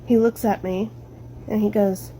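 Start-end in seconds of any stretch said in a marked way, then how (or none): none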